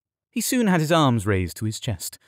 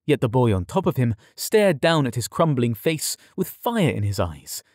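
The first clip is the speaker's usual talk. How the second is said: plain reading voice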